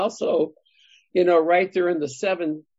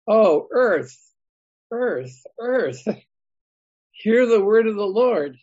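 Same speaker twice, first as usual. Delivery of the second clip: each earth called out higher and louder, with a laugh before the close; emphatic reading; 4 semitones higher, 4 dB louder, slower than usual. Shown as a demonstration. Teacher reading the verse to his Bible study, calling out with emphasis. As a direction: proclaim Oh, earth, earth, earth like a summons. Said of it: "This is done for emphasis".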